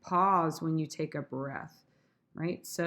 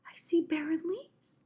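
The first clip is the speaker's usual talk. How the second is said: high, light verse reading rising at the end; raised, lilting Shakespeare delivery; high-pitched recital; 10 semitones higher than usual. Shown as a demonstration. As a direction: lift the whole line up high. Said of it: "Maybe I'll say that fast".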